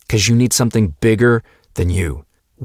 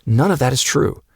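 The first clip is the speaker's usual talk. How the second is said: usual speaking voice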